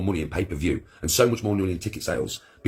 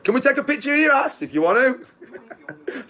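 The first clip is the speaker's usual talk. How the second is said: giggly, much higher fan question; high laughing fan voice; slower than usual. Pretend. Excited fan asking for a photo, giggling high. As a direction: squeak it out through laughter.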